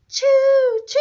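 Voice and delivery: high, loud choo-choo whistle; train whistle sound. Shown as a demonstration. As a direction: toot it like a toy train. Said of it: "what did choo-choo trains say".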